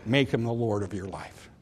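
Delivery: ordinary talk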